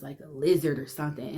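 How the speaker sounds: ordinary delivery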